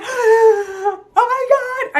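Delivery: high, loud, drawn-out terrified wail; re-enacted screaming panic. Retelling seeing the figure, panicked wail. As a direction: wail it out in horror. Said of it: "I started screaming at my boyfriend".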